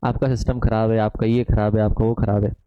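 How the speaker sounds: low monotone recital of complaints; mimicking complaining students. Teacher mocking students who blame his system, low droning mimicry. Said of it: "फिर हमको बोलते हैं".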